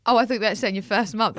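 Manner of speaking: ordinary talk, nothing marked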